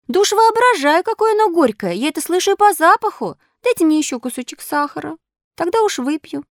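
high-pitched puppet voice, protesting; stubborn complaining puppet. Acted.

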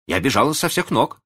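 high-pitched, a touch breathless; out of breath. A narrator's reading. Girl hurrying back with the medicine, a little breathless. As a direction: say it as if just back from running. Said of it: "с трудом переводя дыхание".

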